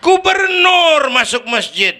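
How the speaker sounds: high, loud proclamation; grand announcement. Shown as a demonstration. Preacher climbing the ranks to governor, grand shout. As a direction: call it out high like a big announcement. High official in rank ladder.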